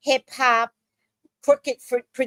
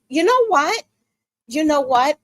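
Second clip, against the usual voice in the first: high, loud exasperated rise; fed up and about to unload; exasperated outburst; 5 semitones higher, 4 dB louder than usual. Real, not acted. Ranting, building frustration.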